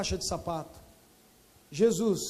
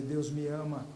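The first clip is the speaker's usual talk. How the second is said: much softer and lower, murmured over and over; tearful repeating; 6 semitones lower, 10 dB quieter than usual. Retold or imitated. Man realising God loves him, softly tearful. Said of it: "E ele não parava de dizer".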